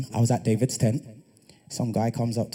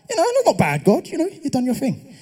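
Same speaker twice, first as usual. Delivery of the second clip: a high, breezy pat on God's back; high mock-casual thanks; 13 semitones higher than usual. Preacher thanking God like a buddy, high and breezy. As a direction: toss it off lightly, pitched way up.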